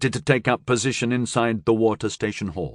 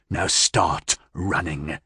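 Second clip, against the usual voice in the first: low, slow, dark voice; possessed man to Billy Joe, slow and threatening; menacing possessed man; 4 semitones lower, much slower than usual. Acted. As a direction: spell out the threat coldly. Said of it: "the possessed said".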